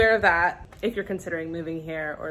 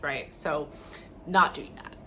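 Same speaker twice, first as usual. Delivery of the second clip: ordinary talk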